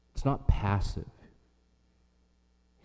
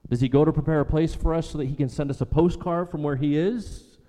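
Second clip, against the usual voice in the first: preaching, rising; 5 semitones higher than usual. Acted. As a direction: build the incredulous questions to the crowd. Rhetorical questions, preaching build.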